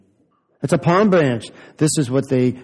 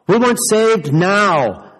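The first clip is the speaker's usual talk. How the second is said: slow, higher, louder plea; pleading call; 5 semitones higher, 3 dB louder, much slower than usual. A narrator's reading. Crowd calling to Jesus, a slow pleading cry. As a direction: beg for rescue, drawn out. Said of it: "The people were saying to Jesus".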